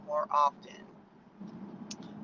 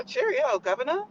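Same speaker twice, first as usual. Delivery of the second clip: hammed-up British accent, a bit higher; mock British accent; 5 semitones higher than usual. Americans mocking the Brit guy, mock British accent. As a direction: tease with a cartoon British accent. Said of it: "making fun of our accent".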